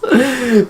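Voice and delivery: short high giggle; a quick bright laugh. Real, not acted. Laughs after joke.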